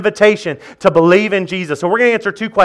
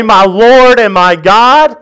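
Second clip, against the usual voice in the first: higher, louder, slow proclaiming; joyful exclamation; 3 semitones higher, 5 dB louder, slower than usual. Acted. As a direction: lift it up in triumph. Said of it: "to be able to say".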